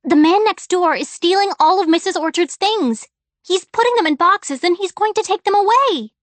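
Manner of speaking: high, loud and pressing; girl pressing her distracted father, loud and urgent; urgent child. A narrator's reading. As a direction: push the alarm on him.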